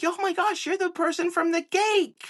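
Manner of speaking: high, gushing fan voice; excited fan. Retold or imitated. Imitating strangers who recognize him from the cake, high and gushing. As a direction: gush like a starstruck stranger. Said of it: "People are like".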